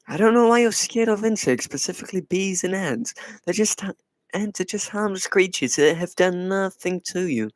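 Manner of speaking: normal talking voice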